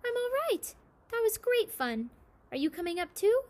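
high, loud happy call from the tree; boy shouting happily to the old man. A narrator's reading. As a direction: call down from the branch. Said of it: "He shouted to Noom Zor Noom".

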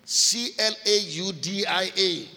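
letters of "C L A U D I A" read one by one for the congregation; spelling it out. Shown as a demonstration. Preacher spells "C L A U D I A" letter by letter for listeners. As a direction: spell "C L A U D I A" letter by letter, evenly spaced.

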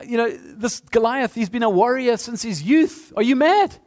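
a king asking if he's lost his mind; incredulous king. Retold or imitated. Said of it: "Saul's like".

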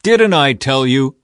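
loud, slow told-you-so; a king exclaiming to his ally. A narrator's reading. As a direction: drag out the vindication loudly. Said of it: "exclaimed to Jehoshaphat".

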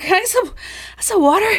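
a thin, needy plea to the waiter; exhausted stranger begging the waiter for water; pleading stranger. Acted. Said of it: "he's like".

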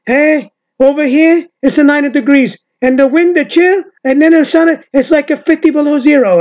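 put-on high, loud Italian American accent; Uncle Louie. Imitating a comedian griping about the cold, Italian American accent. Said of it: "in an Italian American accent".

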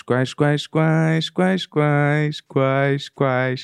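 high-pitched mock English singing; fake English gibberish. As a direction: sing nonsense that sounds like English. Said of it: "Essa famosa arte do embromation". (embromation cantado)

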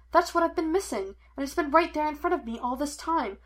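high, eager rush of discovery; excited realisation. A narrator's reading. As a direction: spill out the breakthrough. Excited rush after solving the case.

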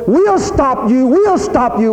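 high, slow, menacing warning; threatening. Retold or imitated. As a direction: warn him off with a menacing threat. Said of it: "they were threatening to use force".

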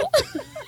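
high burst of laughter. Real, not acted. Remembering the nurse's line, high laugh. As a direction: crack up laughing.

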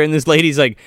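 usual speaking voice

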